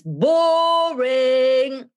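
"boring" stretched very long on a high held note; drawn-out BORING. Acted. Warning that super professional video bores viewers, theatrical drawn-out "boring". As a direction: hold "boring" high and loud, dragging it out.

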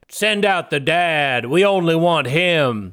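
slow, drawn-out demand; retold gang leader. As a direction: play a leader calling out across a field. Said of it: "stepped forward and he said".

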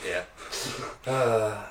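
ordinary delivery

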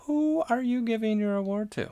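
high, loud put-on host voice; theatrical award question. Host asks co-host for their award pick, mock-theatrical high voice. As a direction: pitch it way up, playfully grand.

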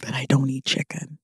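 soft whispered confession; whispered aside. Real, not acted. Whispering to the audience about chicken. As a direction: whisper it as a secret.

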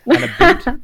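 "a boot" as two words, squeaky high and loud; mock Canadian a boot. Host teasing the guest's Canadian accent, hammed-up high "a boot". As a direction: tease the Canadian about.

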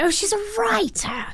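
high, put-on British accent; a posh British voice to mark her as a writer. Host imitating guest in high British accent. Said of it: "I turned British".

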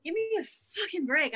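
high, loud, indignant outburst; retelling an angry inner protest; angry exasperation. Retold or imitated. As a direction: push it up in pitch, fed up. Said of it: "part of me was angry".

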